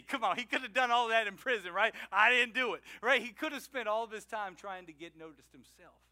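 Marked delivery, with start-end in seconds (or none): 2.1-2.8 mock protest. Prisoner insisting innocence.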